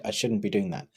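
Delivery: ordinary delivery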